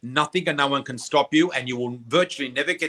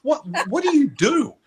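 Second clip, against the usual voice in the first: stunned question; 10 semitones higher, 4 dB louder than usual. Retold or imitated. Doctor stunned, asks.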